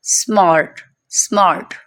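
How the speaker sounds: स्मार्ट with a rounded "aw" vowel; isolated, falling स्मार्ट with "o" colour; "o" sound demo. Shown as a demonstration. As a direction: model स्मार्ट slowly as "smawrt". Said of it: "इनका sound different होगा".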